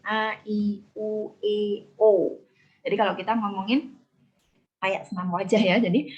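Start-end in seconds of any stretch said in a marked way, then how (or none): 0.1-2.4 each vowel A I U E O shaped wide and separate; clear articulation. Shown as a demonstration. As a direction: exaggerate the mouth on A, I, U, E, O like face exercise.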